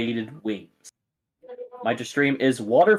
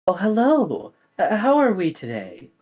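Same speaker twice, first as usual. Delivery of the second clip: higher, lilting hostess voice; sweet mom voice; 7 semitones higher than usual. Acted. Mom greets the party at her kitchen, higher and lilting. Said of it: "very nice looking woman with ... goes".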